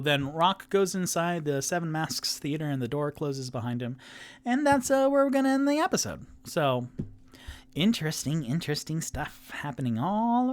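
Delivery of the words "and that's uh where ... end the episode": bright wrap-up. Real, not acted. Excited episode sign-off.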